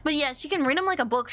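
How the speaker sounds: normal talking voice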